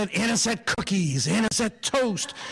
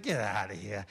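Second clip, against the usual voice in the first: put-on kid scoff; 13 semitones lower, 9 dB quieter than usual. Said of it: "I said".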